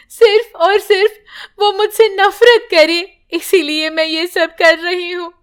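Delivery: weeping mother praying alone; crying prayer. Acted. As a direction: sob out the prayer. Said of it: "रोने लगती है".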